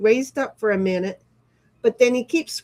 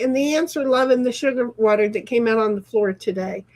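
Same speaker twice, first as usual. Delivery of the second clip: ordinary delivery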